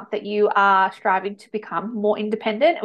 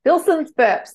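higher, louder, playful call-out; playful exclaim; 7 semitones higher, 5 dB louder, slower than usual. Real, not acted. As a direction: toss it out like a catchphrase. Repeats her own quip louder.

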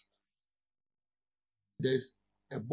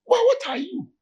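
mock incredulous challenge; 7 dB louder than usual. Real, not acted. Challenging the congregation.

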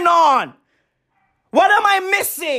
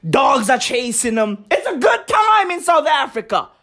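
ordinary delivery

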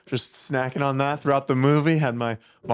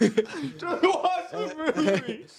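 high laughing mock scold; high, very slow laughing mock scold; 4 dB quieter, much slower than usual. Imitating annoyed moviegoer, high slow laughing scold. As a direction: drag it out, laughing. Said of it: "imitating moviegoers".

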